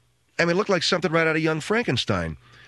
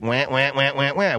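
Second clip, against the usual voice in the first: repeated wah cries; mock animal wailing; imitated animal wah. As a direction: copy the creature's call. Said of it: "this thing's like".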